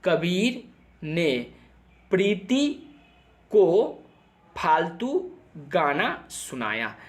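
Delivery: slow, spaced words with long pauses between; slow mnemonic. Shown as a demonstration. Teacher dictating a mnemonic to NEET students, slow and spaced. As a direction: spell the trick out word by word, very slowly. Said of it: "इसके लिए trick बताता हूँ".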